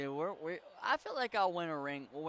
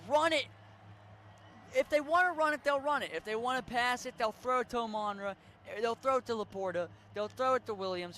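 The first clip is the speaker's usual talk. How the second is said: excited; 8 semitones higher, 6 dB louder than usual. Real, not acted. Hyping up team's play style.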